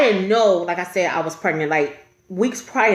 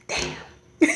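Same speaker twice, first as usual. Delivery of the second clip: whispered disbelief; hushed, sunken reaction; stunned whisper; 3 dB quieter than usual. Retold or imitated. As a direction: let it out under the breath. Retold stunned reaction in waiting room.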